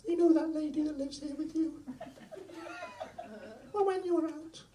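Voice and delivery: very high, squeaky put-on cat voice; high-pitched cat confiding gossip; talking cat. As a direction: tell tales as a pet cat. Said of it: "the cat is actually saying to me".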